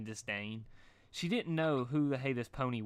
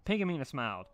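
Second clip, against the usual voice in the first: usual speaking voice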